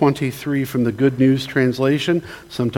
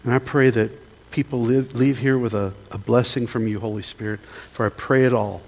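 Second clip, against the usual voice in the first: closing prayer; 3 semitones lower than usual. Acted. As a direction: pray humbly, letting the voice sink by the amen.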